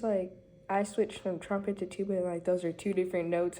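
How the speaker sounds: usual speaking voice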